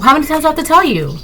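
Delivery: normal talking voice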